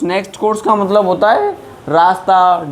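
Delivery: ordinary talk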